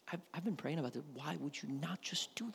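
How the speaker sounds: frustrated believer whispering at God; whispered complaint to God. Retold or imitated. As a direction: breathe it like a hurt private prayer. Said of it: "When you say".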